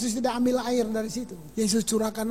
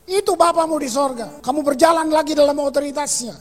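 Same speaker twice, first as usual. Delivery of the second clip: loud, driving sermon voice, higher and louder; preaching at full force; 4 semitones higher, 7 dB louder than usual. Acted. Pastor preaching loudly to the church, full force. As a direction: preach it out to the congregation.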